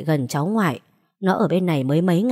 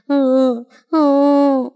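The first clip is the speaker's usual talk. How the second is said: put-on high, louder whining whimper of a child; child's whimper; 8 semitones higher, 6 dB louder than usual. A narrator's reading. Sick boy whimpers to his grandmother, high and whiny.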